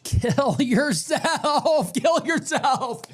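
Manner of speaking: slow, drawn-out words broken by laughter; laughing scorn. Real, not acted. Laughing at a chatter's Aeneid comparison, slow and high. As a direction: laugh it out in disbelief.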